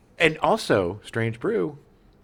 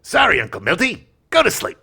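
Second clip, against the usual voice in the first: mock talk-show host; 9 semitones higher, 4 dB louder than usual. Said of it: "Flaherty then turned to Berle and said".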